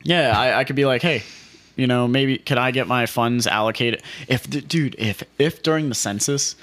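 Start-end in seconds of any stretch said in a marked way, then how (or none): none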